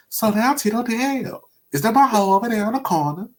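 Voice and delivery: high, fast [name] voice; [name] impression. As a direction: squeak it out like Michael playing a pimp. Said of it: "He's like".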